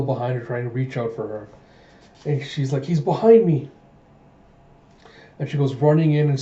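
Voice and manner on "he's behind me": higher, louder cry of alarm; acted fright, pitched up. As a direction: call it out in sudden panic. Fleeing from a pursuer.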